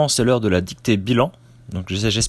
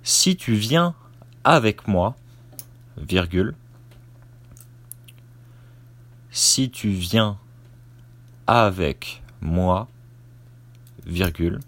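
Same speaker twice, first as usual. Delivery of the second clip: dictating. Shown as a demonstration. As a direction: dictate it chunk by chunk, naming the punctuation. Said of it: "Je commence la dictée".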